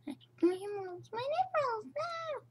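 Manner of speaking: high, squealed mock plea; play-acted squeaky panic; mock desperate wail. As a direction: squeal for your emeralds in a tiny high voice. Play-acted plea over the emeralds.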